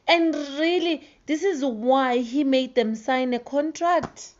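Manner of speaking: outraged rant. Real, not acted.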